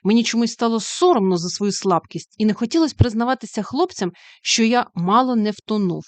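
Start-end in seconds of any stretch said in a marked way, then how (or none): none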